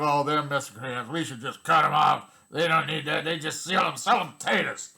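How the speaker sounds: hammed-up mock voice of a snide critic. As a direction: sneer it like the people you despise. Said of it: "Instead of".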